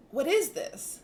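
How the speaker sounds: an excited gasp of discovery. Retold or imitated. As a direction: ask it with rising wonder. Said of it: "and you like".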